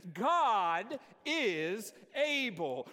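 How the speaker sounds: God is able drawn out slowly for the church to join; call and response. Shown as a demonstration. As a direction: lead the congregation through it slowly. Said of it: "Will you say it with me?".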